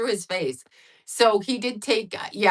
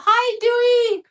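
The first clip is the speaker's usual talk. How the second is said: much higher, louder and slower, a cooing hello; mom's high cooing hello to her tall son; high sing-song greeting; 7 dB louder, slower than usual. Retold or imitated. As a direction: coo his name up high like greeting a baby. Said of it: "Like that".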